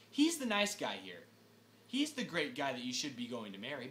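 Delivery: ordinary delivery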